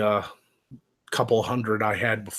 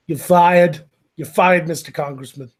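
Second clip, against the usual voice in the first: loud mock Trump firing someone; terrible Trump impression; 3 semitones higher, 7 dB louder than usual. Host fires a congressman in a bad Trump voice. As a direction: bark it out like a reality-show boss. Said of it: "It's a terrible Trump impression".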